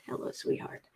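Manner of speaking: breathy whisper of love to the cat; soft pet greeting, voiceless. Real, not acted.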